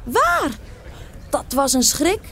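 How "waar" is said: high, startled, rising panic; geschrokken uitroep. A narrator's reading. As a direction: cry out in alarm at the fire. Alarm om brand in de nacht. (angstig en hoog, geschrokken roepend)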